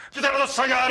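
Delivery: an order shouted out, high and loud; loud, high-pitched command to the accomplice. Acted. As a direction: bark the order loudly. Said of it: "Μη φωνάζεις, τρελέ".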